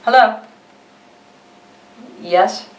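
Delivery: plain reading voice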